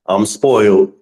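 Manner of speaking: usual speaking voice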